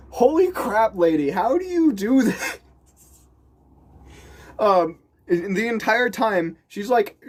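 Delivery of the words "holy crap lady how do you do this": amazed. Retold or imitated. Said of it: "And I'm like".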